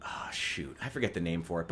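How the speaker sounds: very soft, low aside; muttering to self. Real, not acted. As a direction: mutter as you fail to recall a word. Muttering over a forgotten term.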